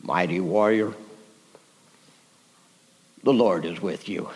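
very low, hushed angelic greeting; mysterious divine voice. Acted. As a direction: speak as an unseen heavenly voice, deep and soft. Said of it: "a voice says".

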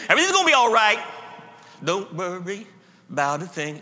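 breezy, careless comfort; glib reassurance. Shown as a demonstration. Preacher showing how the words can sound cheap, breezy. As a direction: toss it off lightly. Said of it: "these words can be said glibly".

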